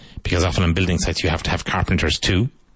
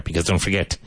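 ordinary delivery

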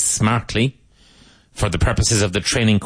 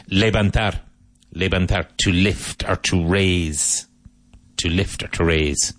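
normal talking voice

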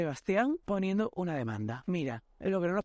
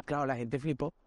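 usual speaking voice

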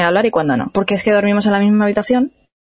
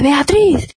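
mock shout; 6 semitones higher, 4 dB louder than usual. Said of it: "empieza a gritar".

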